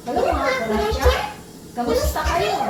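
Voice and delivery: very high-pitched effect voice; chipmunk-high, brisk greeting; voice-changer high voice. Shown as a demonstration. Greeting viewers through the voice changer, very high.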